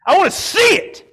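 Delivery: high, forceful shout of longing; impassioned cry. Real, not acted. Preacher longing to see death defeated, impassioned cry. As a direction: cry it out with all your conviction.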